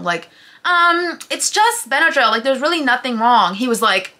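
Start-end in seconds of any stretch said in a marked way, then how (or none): 0.6-3.5 higher, louder dismissive voice; dismissive nurse. Retold or imitated. Imitating the dismissive nurse Cecily, mock higher brush-off. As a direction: brush it off as nothing. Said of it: "acting like I'm tripping".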